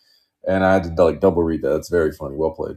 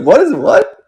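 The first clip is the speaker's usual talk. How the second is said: high-pitched, louder mock bewilderment; baffled disbelief; 13 semitones higher, 5 dB louder than usual. Host retelling reaction to a weird clip, baffled. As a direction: replay a stunned double take in a much higher voice. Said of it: "I was like".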